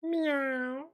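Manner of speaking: high-pitched little kitty mew; cute cat meow. Pretend. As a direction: mimic a cute begging cat. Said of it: "Would I make a cute little cat?".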